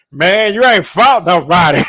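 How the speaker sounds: loud laughing put-on voice; laughing taunt. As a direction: cackle out the taunt. Taunting quote amid laughter.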